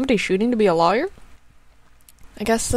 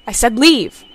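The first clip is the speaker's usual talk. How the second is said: louder, firm command; stern order; 7 dB louder than usual. Acted. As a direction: order him out. Nurse orders son out.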